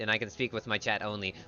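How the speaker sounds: ordinary delivery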